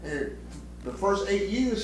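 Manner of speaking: ordinary delivery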